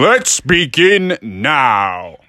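very slow, drawn-out invitation; grandly drawn-out story start; storyteller opening. A narrator's reading. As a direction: stretch each word to open the tale.

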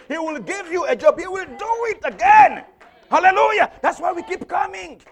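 loud, high, rousing pulpit cry; preacher rallying the faithful; full-force preaching. Real, not acted. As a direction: build to a shouted promise for the congregation.

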